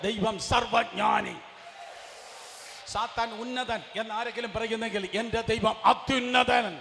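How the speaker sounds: loud, slow pulpit shout; shouting that God is almighty over Satan; shouted preaching. Acted. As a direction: thunder it out to the congregation.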